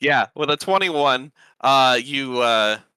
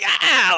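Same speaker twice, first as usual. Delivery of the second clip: high, loud "ah"; short scream fleeing the flames; frightened gnome scream; 13 semitones higher, 6 dB louder than usual. Acted. Gnome running from fire breath, high loud scream.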